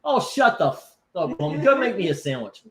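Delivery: high-pitched, joking retort; hammed-up exasperation, not meant seriously; mock annoyance. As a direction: play at bossing your partner around. Joking retort to off-mic partner.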